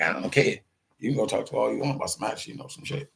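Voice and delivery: ordinary talk, nothing marked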